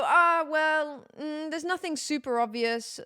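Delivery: high, hemming mock-doctor voice; dismissive doctor. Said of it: "And they go".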